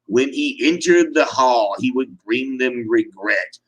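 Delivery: ordinary delivery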